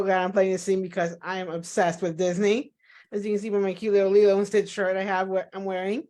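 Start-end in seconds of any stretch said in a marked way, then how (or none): none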